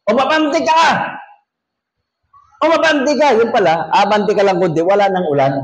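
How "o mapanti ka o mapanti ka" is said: much higher hammed-up call out; mock call. Preacher imitating people yelling to the jeep to move forward. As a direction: call to the jeep driver in a shrill mock yell. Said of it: "Sabi ng mga tao sa jeep".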